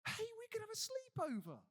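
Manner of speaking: high, loud, far too keen blurt; gobby and impulsive. Retold or imitated. Simon wrecking a holy moment on the mountain, gobby blurt. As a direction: blurt it out like an excited kid. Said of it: "gobby and impulsive and gets it wrong".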